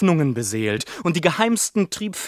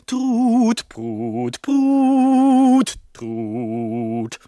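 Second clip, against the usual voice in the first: mock trumpet; 6 semitones higher, much slower than usual. Mimicking music for gentry.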